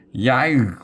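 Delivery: high yelp of alarm; sudden high yelp; startled cry. A narrator's reading. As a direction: yelp it in fright. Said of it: "cried Wiglaf".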